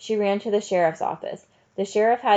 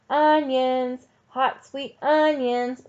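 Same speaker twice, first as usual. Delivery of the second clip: drawn-out, higher-pitched hawker's cry; street vendor's call; 6 semitones higher, 3 dB louder, much slower than usual. A narrator's reading. Onion seller Sam to passers-by on the street, calling out. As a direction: call it out slowly to sell down the street. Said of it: "Sam called out on the street".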